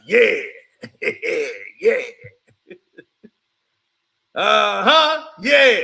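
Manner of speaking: loud, cocky yeah yeah; mock brag at the opponent; taunting. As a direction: egg him on, loud and smug. Voicing Johnson taunting.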